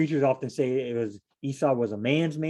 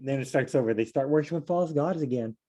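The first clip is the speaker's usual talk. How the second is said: normal talking voice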